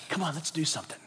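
an unvoiced, soft urging whisper. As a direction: whisper as the Spirit inside. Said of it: "the Holy Spirit in you says".

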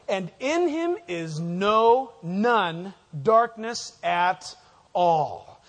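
slow, drawn out "no, none darkness" with weight on "none"; emphatic double negative. Shown as a demonstration. Preacher stressing God has no darkness, slow emphatic. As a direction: lean on "no" then "none" slowly. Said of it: "he's stressing it".

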